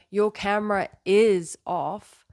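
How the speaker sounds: "is" stretched and punched loud; slow down and lean hard on "is"; stress on is. Shown as a demonstration. Teacher showing how emphasis changes a sentence, stressing "is". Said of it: "every time I emphasize a different word".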